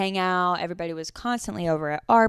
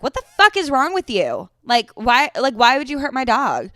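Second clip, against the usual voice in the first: raised, high-pitched angry outburst; retold scream; 6 semitones higher, 7 dB louder than usual. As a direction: tell someone off loudly, re-enacting it. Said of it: "and I screamed at her".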